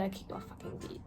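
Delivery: whispered angry aside; muttered under breath. Real, not acted. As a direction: hiss it under your breath.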